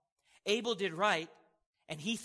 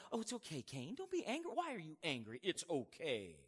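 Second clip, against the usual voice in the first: soft, coddling tone, put on; exaggeratedly tender, hushed reassurance; mock soothing; 6 dB quieter than usual. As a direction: coo it gently as a parody of comforting. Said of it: "God's not sitting there going".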